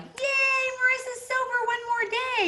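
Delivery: very high, loud sing-song cheer; mock cheer. Recovery cheering each sober day, mock celebratory. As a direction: cheer like a cheesy congratulator.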